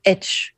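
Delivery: H as a short "etch". Shown as a demonstration. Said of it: "we say H".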